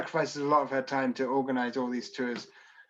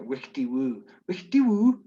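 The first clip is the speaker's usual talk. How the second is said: mock Shetland accent; 4 dB louder than usual. Joking to viewers about how Shetland islanders sound, mock Shetland accent. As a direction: tease the Shetland accent with a sing-song wickety woo. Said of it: "have a very strange accent".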